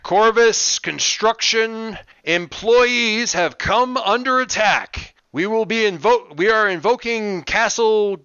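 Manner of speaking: loud, slow megaphone announcement; bullhorn voice. Acted. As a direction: blare it through a bullhorn. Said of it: "the bullhorn voice from out on the school property says".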